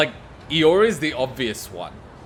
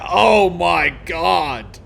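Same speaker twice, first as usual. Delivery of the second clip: ordinary delivery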